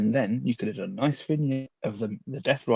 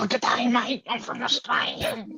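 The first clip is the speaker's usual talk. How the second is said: a bad, higher-pitched Australian accent, slowed on the second line; hammed-up Australian accent, badly faked on purpose; 12 semitones higher than usual. Co-host doing a requested Australian accent in a terrible, hammed-up way. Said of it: "read this in an Australian accent".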